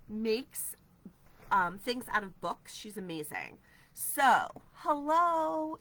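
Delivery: ordinary delivery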